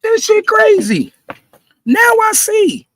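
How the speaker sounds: high, loud disbelieving shout, slow at the end. Real, not acted. Peak of disbelief rant.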